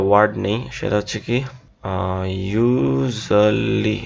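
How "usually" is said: "usually" very slowly, syllable by syllable, yu-za-li; drawn-out Bengali-style "usually", z not zh; habitual local pronunciation. Shown as a demonstration. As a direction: stretch out "usually" with a z in the middle. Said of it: "এরকম আমরা একটা word উচ্চারণ করি".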